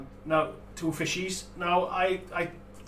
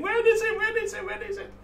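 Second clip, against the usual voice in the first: much higher, pestering repeated question; impatient fans. Retold or imitated. Teasing Kelly about impatient Facebook posts, high nagging voice. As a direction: mimic eager fans nagging for news.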